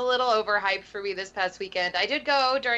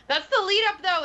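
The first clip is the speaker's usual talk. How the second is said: high laughing gush; excited anime fan; 12 semitones higher, 6 dB louder than usual. Acted. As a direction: squeal it with delight.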